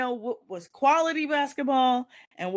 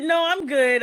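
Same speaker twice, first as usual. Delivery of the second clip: much higher and louder no; alarmed, loud brush-off; firm refusal; 8 semitones higher, 6 dB louder than usual. Retold or imitated. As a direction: shut him down fast. Refusing the man's advance.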